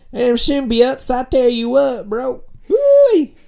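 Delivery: high, loud hillbilly twang. Acted. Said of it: "in a redneck voice".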